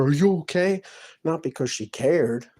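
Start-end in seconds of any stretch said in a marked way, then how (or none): 0.0-0.8 higher, louder fake-caring question; mock concern. Imitating the neighbour who checked on him, mock concern. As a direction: sing it out with put-on sweetness. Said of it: "she came over here".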